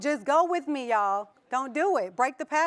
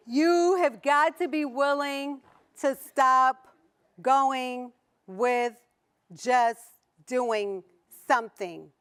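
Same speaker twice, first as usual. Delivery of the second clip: slow emphatic; much slower than usual. Real, not acted. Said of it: "slow emphatic preaching".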